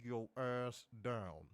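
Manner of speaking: low, slow, soft growled order; low slow deadpan order to sit down; mock stern command. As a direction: drop the voice and lay down the law. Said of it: "I'd be like".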